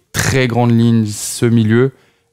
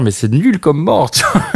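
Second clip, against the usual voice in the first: laughing, voice climbing high; 7 semitones higher than usual. Retold or imitated. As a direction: laugh it out, half terrified. Retelling fear of dying, laughing.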